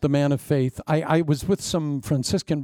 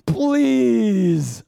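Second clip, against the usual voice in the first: loud, high, long-drawn plea; hammed-up falling cry; desperate begging; 7 semitones higher, 5 dB louder than usual. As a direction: wail it to heaven in mock despair. Repeats the desperate plea to God.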